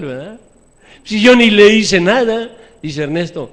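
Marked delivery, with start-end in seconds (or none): 1.0-2.5 cruel denial. Retold or imitated. Father mocking his own cruel excuse to the congregation, loud. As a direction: play the cruel one pretending innocence. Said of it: "la expresión del cruel".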